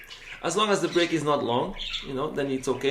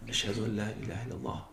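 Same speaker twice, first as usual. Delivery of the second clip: quiet muezzin murmur; 5 semitones lower, 11 dB quieter, much faster than usual. Shown as a demonstration. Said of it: "you might not hear him because he's on the microphone".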